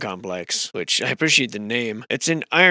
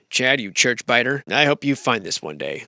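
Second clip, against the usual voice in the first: ordinary talk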